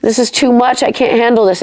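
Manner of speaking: high, pleading whine; whiny complaint. Retold or imitated. Praying to God in hard times, high mock whiny. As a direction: whine that it's all too much. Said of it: "And I've been that way".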